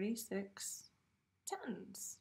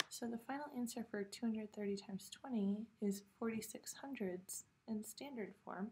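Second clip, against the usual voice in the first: ordinary talk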